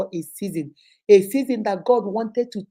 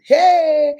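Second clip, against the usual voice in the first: loud, higher, drawn-out hey; gossip's exclamation; 5 semitones higher, 11 dB louder than usual. Acted. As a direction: cry out like a friend with juicy gossip. Acting out a friend bringing gossip.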